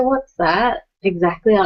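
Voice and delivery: ordinary talk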